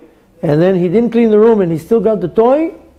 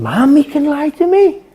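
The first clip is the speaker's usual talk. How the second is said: a hint of a child's high, stunned voice; small child voice, high and hurt; shocked child; 9 semitones higher than usual. Child realizing mommy lies, lightly voiced.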